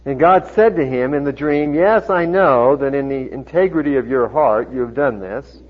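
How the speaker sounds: loud, measured Bible reading; projected pulpit reading voice; scripture reading. A narrator's reading. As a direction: proclaim God's words firmly to the congregation. Reads scripture aloud to congregation.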